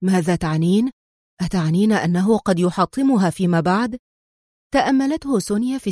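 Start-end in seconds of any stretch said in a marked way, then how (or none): none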